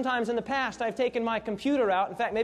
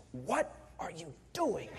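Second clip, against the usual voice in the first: low, hushed whisper of a puzzled bride; wife's hushed question; 7 semitones lower, 10 dB quieter than usual. Retold or imitated. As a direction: mutter it under your breath, mid-ceremony. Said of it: "my wife says to me right there in the ceremony".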